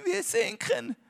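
high, thin mocking whimper; whiny mock panic. Preacher mimics the disciples' panicky whine. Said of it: "so rede ich manchmal auch".

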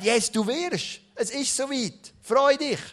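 high, loud cheer of good news; excited angel. Acted. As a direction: burst out with joy. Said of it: "der Engel kommt und sagt". (jubelnder Engel, hoch und laut)

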